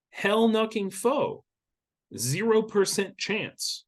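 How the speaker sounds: high, slow and drawn out; emphatic no. Real, not acted. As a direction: spell out a firm no. Emphatic dismissal.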